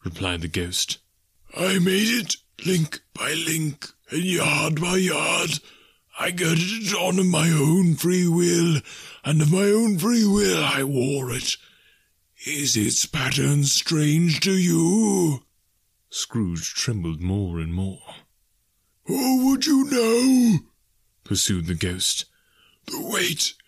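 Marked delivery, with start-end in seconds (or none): 1.6-15.5 slow, high wailing phantom voice; mournful ghost. A narrator's reading. Ghost telling Scrooge of its doom, mournful wail. 19.0-20.6 loud, high, slow spectral wail; commanding ghost. A narrator's reading. Ghost demanding Scrooge believe, loud haunting challenge.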